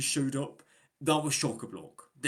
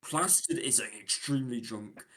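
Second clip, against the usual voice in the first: usual speaking voice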